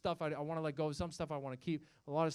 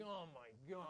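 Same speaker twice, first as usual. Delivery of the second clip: much softer, low, dismayed sigh; dismayed heart; 3 semitones lower, 19 dB quieter, slower than usual. Retold or imitated. The comic's heart reacting to the book, a quiet dismayed gasp. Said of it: "my heart is like".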